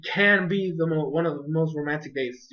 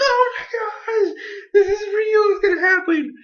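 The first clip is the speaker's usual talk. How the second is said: tearful fan cry; 4 dB louder than usual. Retold or imitated. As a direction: wail it like a heartbroken fan. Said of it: "I might have gotten a little bit too excited".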